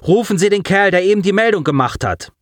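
high, hurried order; urgent command. Acted. Commander to communications officer, urgent high order. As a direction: demand it at once.

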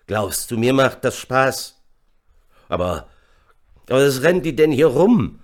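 loud, exasperated challenge; angry shouting. A narrator's reading. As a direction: rant at a subordinate, rising in pitch. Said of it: "Vitius schrie nun". (wütend geschrien)

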